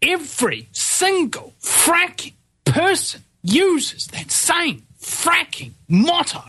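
high, slow, exasperated ranting; fed-up tirade about campaign slogans; worked-up rant. Real, not acted. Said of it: "fuck it, I'm not gonna be calm".

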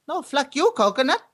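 high mock Chinese accent, indignant; hammed-up Chinese customer accent, punchline. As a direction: swear back in a high put-on Chinese accent. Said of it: "the Chinese goes".

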